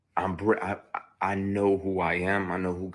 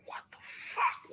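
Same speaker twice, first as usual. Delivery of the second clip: whispered disbelief; 5 dB quieter, slower than usual. Retold or imitated. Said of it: "I'm like".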